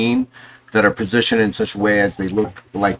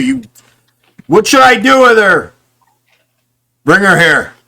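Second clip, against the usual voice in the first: loud call; 10 semitones higher, 9 dB louder than usual. Acted. Sailors calling between decks.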